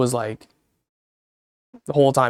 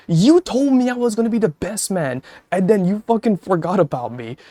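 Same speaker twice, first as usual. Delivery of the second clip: high, whiny mock grievance; mock hurt complaint; 6 semitones higher than usual. Streamer mock-whining at a friend who forgot him. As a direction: play the wounded best man, half joking.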